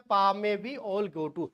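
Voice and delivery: second mnemonic said slowly; slow recitation. Shown as a demonstration. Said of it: "एक याद करना है".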